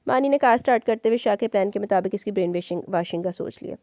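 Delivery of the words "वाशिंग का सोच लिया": ordinary delivery, nothing marked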